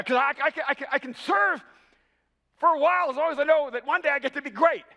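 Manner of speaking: high, loud, impassioned sermon delivery; ringing pulpit declaration; full-force preaching. Acted. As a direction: preach it to the whole room, pitched right up.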